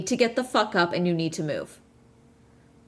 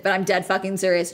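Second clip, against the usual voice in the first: usual speaking voice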